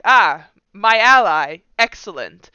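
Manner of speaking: loud, drawn-out mock welcome; lightly voiced enemy. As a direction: greet a supposed ally with hammed-up approval. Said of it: "He's gonna be like".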